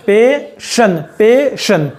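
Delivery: पेशन said with शन at the end; sh sound in passion. Shown as a demonstration. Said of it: "इसका तलफ़ुज़ होगा शन का". (पेशन में "शन" की आवाज़)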